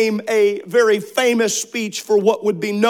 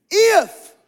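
If flung out higher still; shouted If; 10 semitones higher, 3 dB louder than usual. Shown as a demonstration. Preacher repeating the call, If ringing out high. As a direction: lift If up like a cry. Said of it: "Everybody shout".

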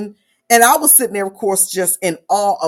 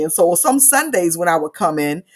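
usual speaking voice

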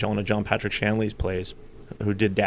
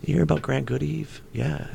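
hushed act; 3 semitones higher, 6 dB quieter than usual. Retold or imitated. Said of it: "I did my whole act at that tone".

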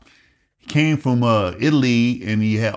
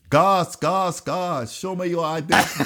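higher-pitched mock Mexican accent; imitated Mexican accent; 7 semitones higher, slower than usual. Imitating a flight mate from Mexico, thick accent. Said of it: "could barely speak any fucking English".

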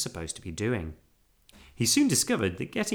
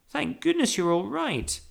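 put-on high-pitched girl's relief; relieved cry; 13 semitones higher than usual. A narrator's reading. Girl finding bear safe, relieved cry. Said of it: "cried Judy".

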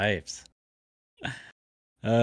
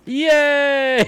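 cheering yay; 8 dB louder than usual. Real, not acted.

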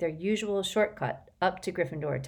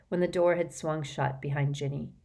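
ordinary delivery

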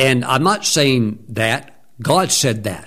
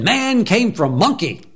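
put-on child insisting; 9 semitones higher than usual. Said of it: "I said".

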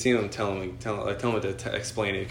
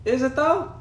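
skeptical; 4 dB louder, slower than usual. Retold or imitated. Skeptical retort to mom retold.

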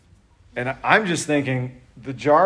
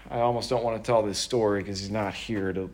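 usual speaking voice

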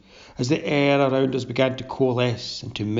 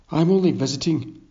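a sharp, higher, fast retort; snapped; 5 semitones higher, faster than usual. A narrator's reading. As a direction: bite the words off quickly. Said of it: "I snapped".

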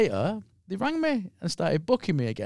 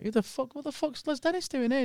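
hushed disbelief; 9 semitones higher, 9 dB quieter than usual. Retold or imitated. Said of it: "I was like".

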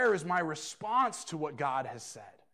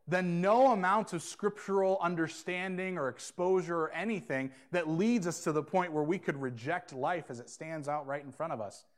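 normal talking voice

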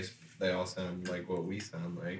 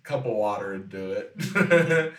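ordinary talk

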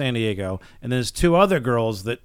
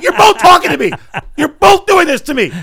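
much higher, loud exasperated yelling; frantic shout; 10 dB louder than usual. Retold or imitated. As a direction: shriek in overwhelmed frustration. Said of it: "I'm like".

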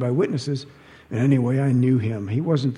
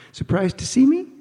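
high, sing-song and louder; mock-sweet taunt; 12 semitones higher, 5 dB louder than usual. To her stunned ex-husband, a high, mocking sing-song. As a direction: tease him with fake sweetness, pitched up high.

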